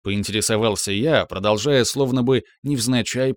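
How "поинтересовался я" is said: ordinary delivery, nothing marked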